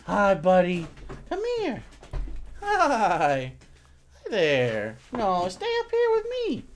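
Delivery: high cooing with gliding, drawn-out words; pet talk. Real, not acted. Owner greets the dog and coaxes it close, cooing. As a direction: coo to a dog, pitch swooping up.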